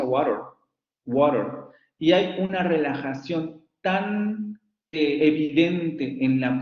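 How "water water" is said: water said American, flapped "woro"; American pronunciation. Shown as a demonstration. Teacher showing American water to Spanish-speaking students. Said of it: "en inglés norteamericano esa palabra se pronuncia".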